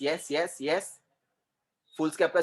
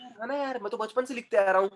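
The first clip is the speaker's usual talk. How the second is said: high-pitched mock protest; put-on higher voice of complaint; imitated protesting learner; 5 semitones higher than usual. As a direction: play the pupil objecting, pitched up. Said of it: "बचपन से अपन बोल रहे हैं".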